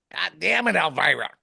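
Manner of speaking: imitating a cranky husband yelling for the net; mock yell across the lake. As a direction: call out to his wife across the water, half-joking. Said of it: "he's just yelling at his wife".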